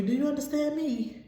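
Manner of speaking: usual speaking voice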